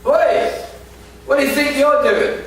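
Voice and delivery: high angry yell at a stranger; woman shouting. Retold or imitated. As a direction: shout it out, accusing. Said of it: "a woman shouting".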